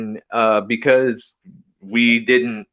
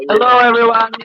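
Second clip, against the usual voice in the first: bright greeting; 11 semitones higher, 6 dB louder than usual. Real, not acted. Cheerful greeting joining the call.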